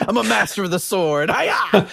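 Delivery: high, loud mock samurai boast. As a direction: ham it up like a samurai. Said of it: "That's my Samurai".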